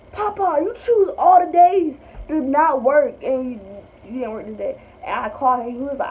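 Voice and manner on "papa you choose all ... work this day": whiny complaint. Retold or imitated. Complaining to her grandpa.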